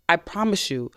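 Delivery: ordinary talk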